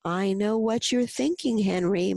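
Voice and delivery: plain reading voice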